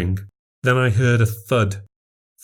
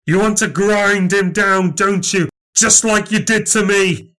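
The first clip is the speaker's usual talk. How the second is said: bitter accusation; 9 semitones higher than usual. Acted. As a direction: spit out years of grievance. Possessed Dad accusing Nan.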